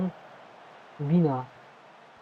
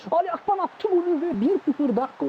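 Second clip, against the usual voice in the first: excited goal shout; 13 semitones higher than usual. Real, not acted. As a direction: scream the goal with joy. Goal celebration in commentary.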